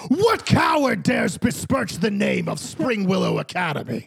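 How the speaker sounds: booming warrior coach shout; loud gung-ho soldier. Acted. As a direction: bellow like an offended war chief. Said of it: "Coach Korg is like".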